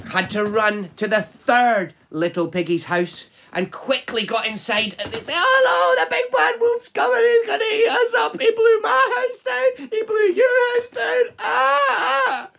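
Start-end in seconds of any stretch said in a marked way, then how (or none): 5.4-12.5 very high, loud squealing piggy voice with mock sobs; hammed-up frightened piglets; panicking piggies. Piggies fleeing to their sister, high panicky squeals.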